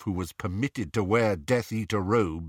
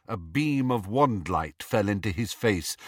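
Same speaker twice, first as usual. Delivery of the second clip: ordinary delivery, nothing marked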